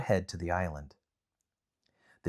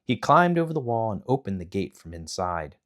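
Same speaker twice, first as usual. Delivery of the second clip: ordinary delivery, nothing marked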